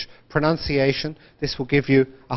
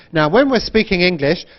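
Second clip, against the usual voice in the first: ordinary delivery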